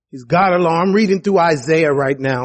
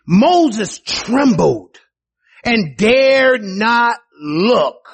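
slow, awed emphasis; 3 semitones higher, much slower than usual. Acted. Preacher stresses trembling.

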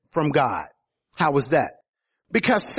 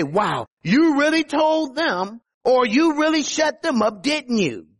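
higher, impressed congratulation; admiring praise; 6 semitones higher than usual. Acted. Onlookers congratulating someone for winning an argument, admiring. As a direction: cheer them on like an admiring onlooker. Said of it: "pat you on the back and say".

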